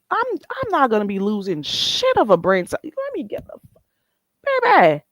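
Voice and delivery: lightly voiced exasperation, rising high at the end; a hint of fed-up disbelief, pitch jumping up; mock exasperated. Retold reaction to bad grammar.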